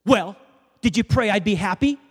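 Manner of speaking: loud, high, mock-confrontational challenge; cranky waitress. Waitress returning to confront praying customers, mock cranky. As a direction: demand it like an impatient waitress, hammed up. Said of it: "like, cranky and just like".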